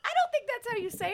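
high teasing pushback; teasing disbelief. Real, not acted. Friend teasing a mispronounced brand, high and teasing. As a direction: poke fun at the wrong word. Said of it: "teasing a mispronunciation".

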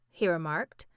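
ordinary delivery

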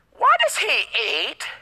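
snooty, high, drawn-out disdain; bougie high voice. Acted. Preacher mimics Pharisees questioning the disciples, bougie high voice. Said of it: "my voice just went to that ... got high voices".

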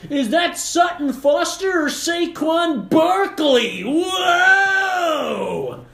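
high, loud, drawn-out hollering; mock clueless sports fan. Sports fan watching the Tonys, mock confused shouting. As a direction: yell in exaggerated bewilderment, stretching words. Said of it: "I don't watch the Tonys and go".

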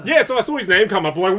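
usual speaking voice

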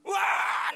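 loud, high mock baby cry; sudden loud "waaah"; imitated baby wail. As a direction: bawl like an infant. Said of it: "nangis terus".

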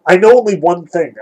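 ordinary talk, nothing marked

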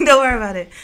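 laughing through the words. Real, not acted. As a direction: giggle it off. Laughing off her own joke.

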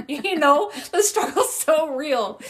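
loud, high, laughing aside. Real, not acted. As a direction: say it through real laughter. Laughing with friend over cartoons.

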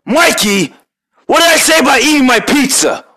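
high, loud scolding in a different voice; annoyed scolding. Acted. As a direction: tell Mikey off up high. Scolding Mikey about pizza.

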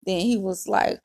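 usual speaking voice

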